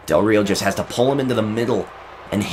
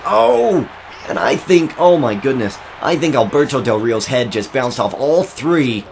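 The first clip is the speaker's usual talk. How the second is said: astonished play-by-play; 3 semitones higher than usual. Real, not acted. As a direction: exclaim at a huge wrestling slam. Live play-by-play of a big slam.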